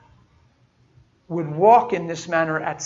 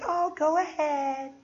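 higher, slow, sugary condescension; patronizing; 9 semitones higher, 4 dB quieter, much slower than usual. Retold or imitated. Lady humouring a little girl who offers prayer, patronizing. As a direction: humour the child like she's cute. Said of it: "she's patronizing".

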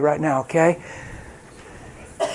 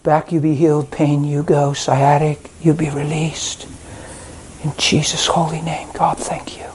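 prayer. Real, not acted. Healing prayer over a man.

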